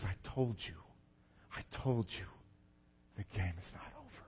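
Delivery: very soft, hushed tender dad; tender father. Retold or imitated. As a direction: murmur it through tears. Tearful dad whispers to son.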